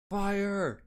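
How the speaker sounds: high, urgent calling out; high-pitched acted shouting for help; acted alarm call. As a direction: raise the alarm to the village. Villager raises the alarm about fire.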